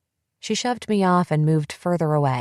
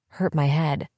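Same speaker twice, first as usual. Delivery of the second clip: ordinary delivery, nothing marked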